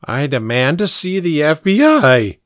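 put-on firm boy's demand squeaking on the last syllable; firm, cracking at end. A narrator's reading. Pink confronting Hod and Lefty, firm with a squeaky finish. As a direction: start firmly, let the final letter jump up squeakily. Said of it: "Pink said firmly, ... squeaky hinge voice".

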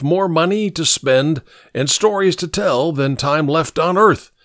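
put-on lofty drunken pronouncement; theatrical old scrounger. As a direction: offer a tale like a tipsy old man.

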